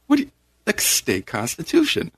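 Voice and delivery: high, retold exasperated retort; incredulous comeback. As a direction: answer back as if it were obvious.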